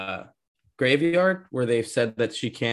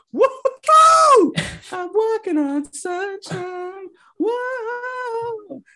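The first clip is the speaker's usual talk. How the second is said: high whooping cheer, half-sung in the middle; mock celebration; 4 dB louder, much slower than usual. Imitating kids celebrating outside while witches burn, mock celebration.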